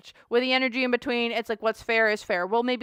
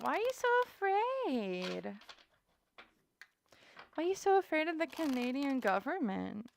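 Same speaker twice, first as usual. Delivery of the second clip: teasing mock pity; 5 semitones higher, 5 dB quieter than usual. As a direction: needle them in a singsong coo. Teasing question about her fear.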